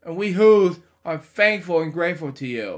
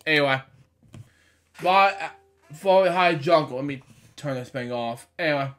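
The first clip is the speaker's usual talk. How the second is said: ordinary delivery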